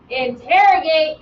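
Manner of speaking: "Interrogate" said slowly and firmly, each syllable clear; word emphasised. Shown as a demonstration. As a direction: lean on "Interrogate" so its root is heard. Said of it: "What does that word sound like?".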